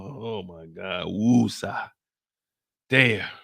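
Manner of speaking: calm yourself after a scare; shaken. Acted. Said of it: "had me scared".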